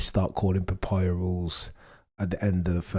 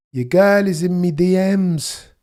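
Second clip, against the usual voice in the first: lightly voiced Jamaican accent, high and loud; bad Jamaican accent; 10 semitones higher, 10 dB louder, slower than usual. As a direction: try a Jamaican accent and miss. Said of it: "I can't even do the accent".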